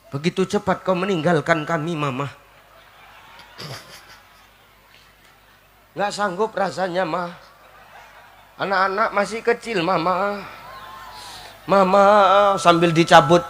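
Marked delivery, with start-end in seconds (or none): 0.1-12.0 low, mournful widower's lament; hammed-up tearful mourning; mock crying. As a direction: wail theatrically like a grieving husband. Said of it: "Bojomu nangis".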